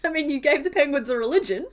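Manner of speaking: real laughter around loud, high words; laughing. Real, not acted. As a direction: tease through your laughter. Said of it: "I'm sorry for laughing".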